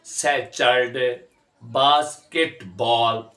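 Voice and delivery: सैटरडे and बास्केटबॉल slowly split into three beats each; drawn-out, separated सैटरडे, बास्केटबॉल; syllable by syllable. Shown as a demonstration. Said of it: "अब इसको इकट्ठा जब होता है".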